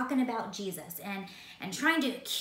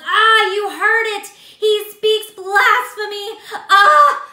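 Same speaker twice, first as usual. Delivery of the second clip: much louder, much higher overacted outrage with a cry; drama llama; 11 semitones higher, 19 dB louder, slower than usual. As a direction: ham up the scandalised high priest. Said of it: "Caiaphas was being a drama llama".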